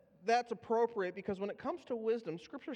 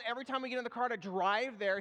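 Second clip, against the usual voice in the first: ordinary delivery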